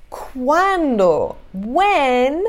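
drawn-out, exaggerated "when?"; expressive questioning. Shown as a demonstration. Teacher showing students how to express "when", exaggerated asking. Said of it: "expressar, isso é uma coisa física".

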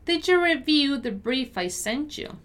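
careful, measured read rising at the end; deliberate pronunciation model. Shown as a demonstration. Teacher models sentence slowly first.

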